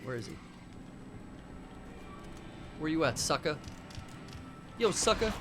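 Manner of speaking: ordinary talk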